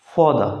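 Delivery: फौदा said with no r sound, fau-da; British non-rhotic form. Shown as a demonstration. Teacher demonstrating British pronunciation. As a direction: drop the r in फौदा. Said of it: "आर को नहीं पढ़ते".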